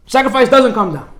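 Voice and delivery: normal talking voice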